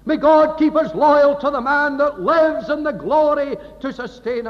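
full-force pulpit prayer, loud and high; fervent preaching. Acted. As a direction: lift the hall with full-voiced pleading.

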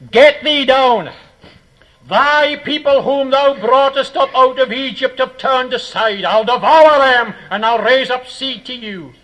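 loud, high declaiming command; thundering God. Acted. God ordering Moses down from Sinai, thundering. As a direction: pronounce judgment in God's voice. Preacher voices God's angry command.

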